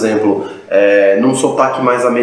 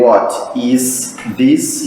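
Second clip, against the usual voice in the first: very slow Brazilian Portuguese reading of "what is this"; Portuguese-style learner reading; much slower than usual. Shown as a demonstration. Teacher imitates a layperson reading, slow Brazilian accent. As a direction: read "what is this" word by word with Brazilian sounds. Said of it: "como a gente pronunciaria em português".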